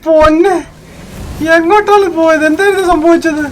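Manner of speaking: frustrated shout. Real, not acted. Frustrated gamer after crash.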